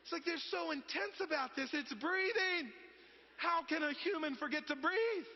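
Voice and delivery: high, loud exasperated disbelief; comic incredulity. Real, not acted. Mocking the over-serious breathing class.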